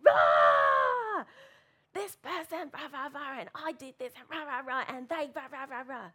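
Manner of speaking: high, loud furious ranting; loud frustrated venting to mum; angry rant. Retold or imitated. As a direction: blow up in a hammed-up mock rant.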